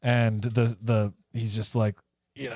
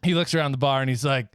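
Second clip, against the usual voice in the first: ordinary talk, nothing marked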